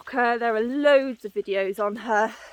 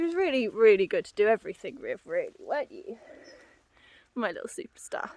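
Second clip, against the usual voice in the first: tender whisper; faster than usual. Real, not acted. Cooing to her horse.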